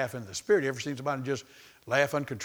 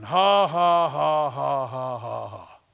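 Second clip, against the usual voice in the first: loud, slow ha-ha-ha fading down; measured laugh starting big and sinking in pitch; deliberate fake laugh; 5 dB louder, much slower than usual. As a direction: laugh on purpose, syllable by syllable. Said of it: "went right on back to laughing".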